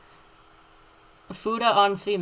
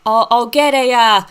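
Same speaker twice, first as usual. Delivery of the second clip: put-on thick Boston accent, higher and louder; 4 semitones higher, 6 dB louder than usual. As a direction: play it broad, like a Southie local. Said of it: "talks in an extremely thick Boston accent".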